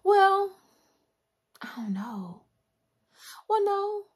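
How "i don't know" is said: unvoiced whisper to herself; whispered aside. Real, not acted. Musing to herself about the schedule, whispered. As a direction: breathe it as a private aside.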